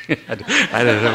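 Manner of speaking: breaking into laughter while talking; laughing. Real, not acted. Preacher tickled by his own dead-body example, laughing. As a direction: lose the sentence in a real laugh.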